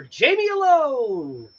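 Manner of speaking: high, loud, drawn-out segment title; mock show announcer. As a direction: announce it like a game show host. Announces his solo show segment.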